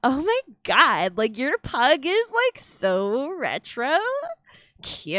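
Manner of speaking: put-on high, slow drawn-out Valley Girl gush. Shown as a demonstration. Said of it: "one of the easier voices to put on".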